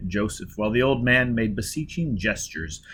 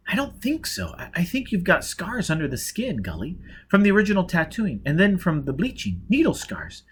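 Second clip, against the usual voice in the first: gently explaining; 7 semitones higher than usual. A narrator's reading. Gisbella explaining, high.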